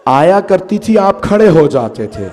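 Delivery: slow, drawn-out plea, swelling then dropping away; acted tearful lament. Bibi weeps to her father about standing in court, acted tearful lament. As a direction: cry out to a father in slow, performed grief. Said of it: "रो के कहा".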